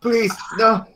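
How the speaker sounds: high, desperate plea; pleading old man. Acted. Old man begging the cop to stop, high and desperate. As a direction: beg weakly as he is hit.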